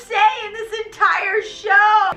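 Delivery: high-pitched, hammed-up protesting; mock protest. Christine refusing Raoul's invitation.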